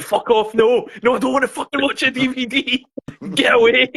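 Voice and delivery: husband waving off his wife, mock annoyed; mock gruff husband. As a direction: brush her off in a put-on voice. Husband voiced snapping at wife.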